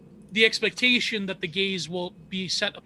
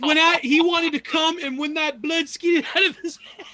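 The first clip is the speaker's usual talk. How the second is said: New Jack impression; 9 semitones higher, 4 dB louder than usual. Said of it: "and he's like".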